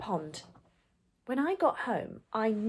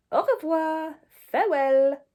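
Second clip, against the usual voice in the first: cool snail farewell; 6 semitones higher, 5 dB louder than usual. Acted.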